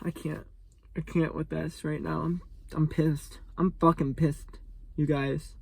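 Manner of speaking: real anger pulled down to almost nothing; quiet seething. Real, not acted. Seething after the rant.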